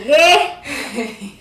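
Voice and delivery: loud, high, laughing "what?"; mock disbelief. Friend teasing about gluten free pancakes, mock disbelief.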